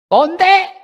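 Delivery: "꼰대?" thrown up high and loud as a shocked question; incredulous "꼰대?". Retold or imitated. Young people sneering at elders: "꼰대?" as a high, loud jeer.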